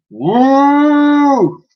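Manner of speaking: high long loud mammoth call; mammoth trumpeting. Acted. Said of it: "let out a giant".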